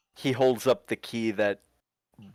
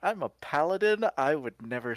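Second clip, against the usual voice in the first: a higher, rising "I'm a paladin"; proud declaration; 7 semitones higher than usual. Acted. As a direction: announce it with wounded pride. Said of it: "he goes".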